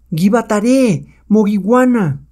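high-pitched angry witch cry; furious shout. Acted. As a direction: shriek the order in fury. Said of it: "La bruja blanca grita furiosa".

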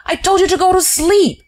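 high, loud angry scolding. Acted. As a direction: hammer the orders home. Shears scolding Wilson.

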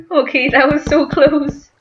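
normal talking voice

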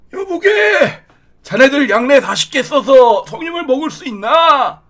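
high, put-on old man voice. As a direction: croak it like a village elder. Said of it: "할아버지 톤으로". (할아버지 톤)

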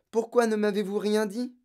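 put-on high-pitched child's question; dismayed and insistent; upset reproach. A narrator's reading. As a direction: ask it as an accusation. Girl reproaches teacher after exclaiming.

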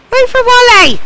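very loud, high yell; shouting. Real, not acted. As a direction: bellow it across the distance. Calling after fleeing creature.

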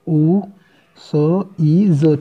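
letters stretched out one by one; spelling it out slowly. Shown as a demonstration. Teacher spelling Lucy's for the class, very slow. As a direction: drag each sound out slowly. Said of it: "इस तरह से ल".

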